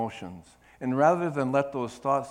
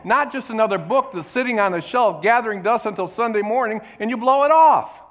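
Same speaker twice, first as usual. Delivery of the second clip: loud, high preaching build; fiery sermon voice; 7 semitones higher, 9 dB louder than usual. Acted. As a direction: drive it home from the pulpit. Preaching at full force.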